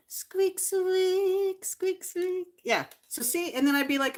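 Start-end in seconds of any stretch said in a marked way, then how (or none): 0.1-2.5 high-pitched squeaking in place of the toy; pretend toy squeak. Doll seller filling in for a squeakless mouse toy, pretend squeaks. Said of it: "you gotta use your imagination".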